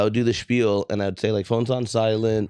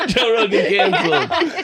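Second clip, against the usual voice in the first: laughing; 14 semitones higher, 5 dB louder than usual. Retold or imitated. Laughing through the prank line.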